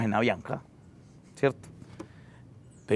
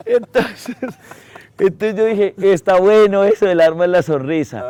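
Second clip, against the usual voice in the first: higher and louder, still laughing; 7 semitones higher, 7 dB louder than usual. Real, not acted. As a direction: talk through leftover laughter. Still laughing after punchline. (riendo todavía, más agudo y fuerte)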